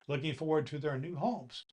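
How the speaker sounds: ordinary delivery, nothing marked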